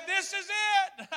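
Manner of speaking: high, loud, drawn-out despair; mock panic. Rafter spinning out of control, hammed-up doomed cry.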